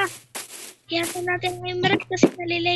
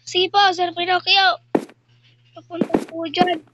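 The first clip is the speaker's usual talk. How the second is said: usual speaking voice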